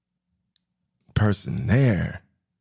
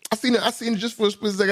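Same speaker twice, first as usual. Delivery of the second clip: fast, high excited rush; 11 semitones higher, faster than usual. Real, not acted. Telling the audience about spotting Jessica Alba, fast excited rush. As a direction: blurt it out thrilled. Excited recall of seeing her.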